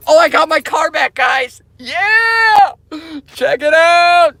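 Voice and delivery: high, loud whoop of pride; excited showing off. Retold or imitated. Proud owner showing his car to viewers, excited. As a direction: hype up the car for the camera.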